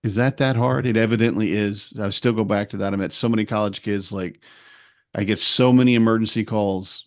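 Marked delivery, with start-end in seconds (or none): none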